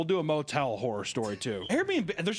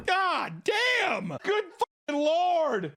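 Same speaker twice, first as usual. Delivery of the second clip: much higher, louder exclamation; shocked outburst; 13 semitones higher, 3 dB louder, much slower than usual. Real, not acted. Reacting to the woman thrown downstairs, shocked outburst. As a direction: blurt it out in disbelief. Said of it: "reaction to assault".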